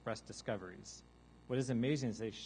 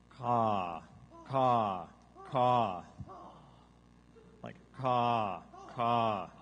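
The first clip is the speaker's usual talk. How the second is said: long loud falling gull caw; imitated seagull call sliding down in pitch; drawn-out demonstration caw; seagull caw, descending; 7 dB louder, much slower than usual. Shown as a demonstration. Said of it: "like a caw with a descending pitch".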